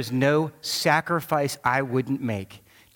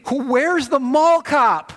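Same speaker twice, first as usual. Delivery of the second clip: high, loud frantic call for the mall cop; panicked shout; 11 semitones higher, 6 dB louder, slower than usual. Retold or imitated. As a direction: yell it out, searching in retold panic.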